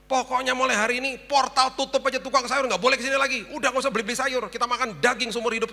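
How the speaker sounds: high, loud mock tirade; angry rant. Pastor imagining scolding the housekeeper over vegetables, angry. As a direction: lay down the law in hammed-up anger. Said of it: "saya marah-marah sama dia".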